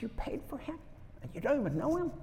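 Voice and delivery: high, soft, unvoiced amazement; a retold hushed, choked question; tearful whisper. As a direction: whisper it as if close to tears. Crying driver, whispered.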